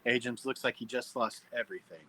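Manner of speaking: ordinary delivery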